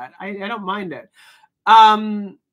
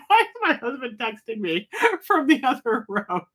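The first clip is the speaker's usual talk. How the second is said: amused quote; 4 semitones higher than usual. Retold or imitated. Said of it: "I'm like".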